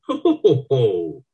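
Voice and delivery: high booming ho-ho laugh; hearty laugh. A narrator's reading. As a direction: let out a big laugh. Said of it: "Bella, laughing".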